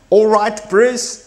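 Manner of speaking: mock Australian accent. Tourist in Australia greeting a local, hammed-up Aussie accent. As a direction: hail a mate named Bruce in a put-on Australian accent. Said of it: "And then we go to Australia".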